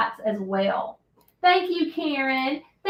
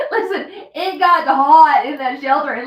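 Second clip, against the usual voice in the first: laughing; 4 semitones higher, 9 dB louder than usual. Real, not acted. Laughing while telling.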